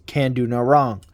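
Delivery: ordinary talk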